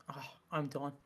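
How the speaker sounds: soft, spent, far-off sigh of a voice; weary kettle voice. Pretend. A kettle done boiling, sighing softly. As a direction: sound like an exhausted kettle giving up. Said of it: "it goes".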